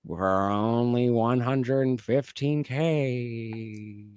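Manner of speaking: slow, eerie ghoul voice drawn out; ghoulish senator. Retold or imitated. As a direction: loom in like a ghoul with a request.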